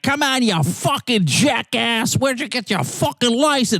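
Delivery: high, slow mock rant; angry driver's rant, high and slow. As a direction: rant like a driver who lost it. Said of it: "really lost it".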